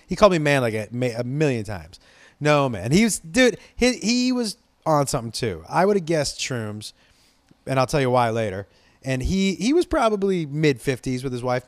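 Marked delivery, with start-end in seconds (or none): none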